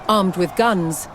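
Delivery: usual speaking voice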